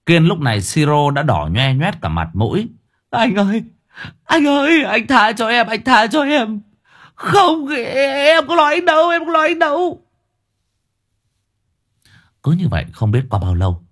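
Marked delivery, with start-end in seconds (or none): 3.1-10.0 high, pleading whimpering voice; desperate begging. Acted. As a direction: beg him to stop hitting. Beaten Kiên begging for mercy. (van xin hoảng sợ giọng cao)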